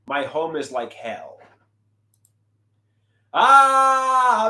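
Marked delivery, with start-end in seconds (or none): 3.4-4.5 loud high pained scream "Ah". Acted. Artist showing his painting, screams loud and high. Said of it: "I'm screaming".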